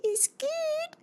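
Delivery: very high, sing-song offering voice; creepy sweet queen. Retold or imitated. Disguised queen offering the apple, very high sing-song. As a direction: tempt her with a cartoonish squeak. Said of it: "holding up an apple and being like".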